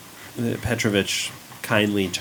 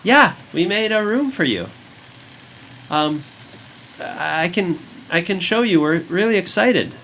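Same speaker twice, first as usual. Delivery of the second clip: put-on high, eager child's voice; 9 semitones higher, 4 dB louder than usual. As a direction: chirp it like an excited kid. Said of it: "the child, sweeping now turns and says".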